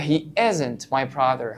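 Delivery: correct form, slowly. Shown as a demonstration. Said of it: "لا".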